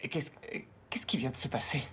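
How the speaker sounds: whispered, bewildered question; dazed whisper. Real, not acted. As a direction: barely breathe the question out. Dazed after the spell.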